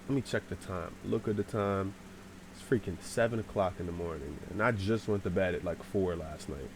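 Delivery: low, soft and tired; drowsy low grumble; sleepy grumbling. Retold or imitated. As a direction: say it half asleep, quietly put out. Half asleep, checking the time.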